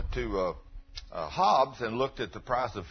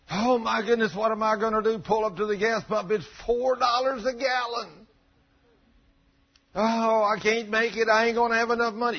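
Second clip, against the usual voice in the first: mock worried believer; 10 semitones higher, 5 dB louder than usual. Preacher mocking a fretful believer, high and whiny. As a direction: ham up the panic at the pump. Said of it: "You're thinking".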